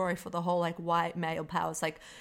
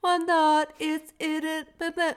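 higher, louder mock of his odd delivery; mocking Jared Leto; 9 semitones higher, 5 dB louder, slower than usual. Mocking the villain's weird speech to co-host. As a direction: parody the villain's pompous speech. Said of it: "why does he talk like that".

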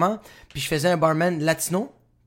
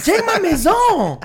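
high, loud, drawn-out brag; hammed-up influencer holler; mock show-off YouTuber; 12 semitones higher, 5 dB louder, much slower than usual. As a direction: crow it slowly like a bragging vlogger. Mocks YouTuber showing off his house.

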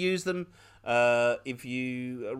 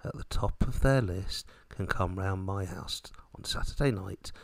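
serial killer; 7 semitones lower, 4 dB quieter than usual. Pretend. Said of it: "sound like a serial killer".

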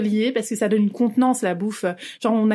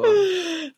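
high burst of laughter; 10 semitones higher than usual. Real, not acted. Laughing at memory.

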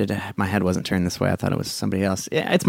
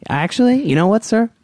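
higher, pointed customer-service snap; sharp high retort; 12 semitones higher than usual. Acted. Attendant rounds on a rude passenger, high and pointed. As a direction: turn on him with sugary sharpness.

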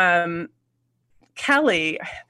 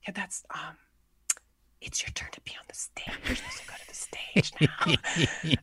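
breathy unvoiced whisper, much softer; retold whisper; 11 dB quieter than usual. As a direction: whisper it so the audience can't hear. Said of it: "I just remember her whispering".

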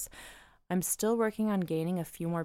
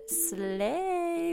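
much higher, sassy exclamation; sassy slay; 12 semitones higher than usual. Retold or imitated. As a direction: squeal it with flair. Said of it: "another worker replied".

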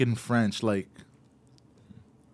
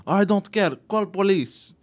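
higher, mock agitated broken English; imitating the other driver; 7 semitones higher, slower than usual. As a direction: play the panicking driver, higher. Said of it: "he starts freaking out".

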